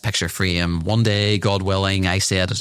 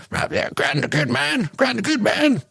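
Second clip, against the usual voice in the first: grandchild's voice. Pretend. Grandchild begging grandad for the story, high childish voice. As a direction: beg grandad for the tale in a squeaky child voice.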